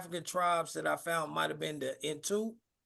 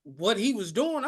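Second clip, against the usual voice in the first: usual speaking voice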